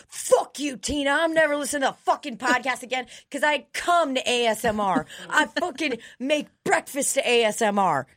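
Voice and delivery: hammed-up indignant outburst; outraged listener. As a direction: rant like an offended fan quitting in a huff. Mimics an outraged podcast listener.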